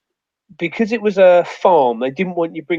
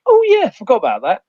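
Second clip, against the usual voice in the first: casual mock innocence; 8 semitones higher, 5 dB louder than usual. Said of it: "I went".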